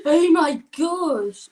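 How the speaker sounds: thrilled exclaim. Real, not acted. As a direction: drag it out in sheer delight. Child thrilled by the chargers.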